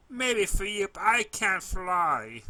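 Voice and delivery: high, loud, slow tearful reply; tearful filly. A narrator's reading. Sniffling filly telling the pony she can't fly, slow loud tearful. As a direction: drag out the last words, high and loud, near tears.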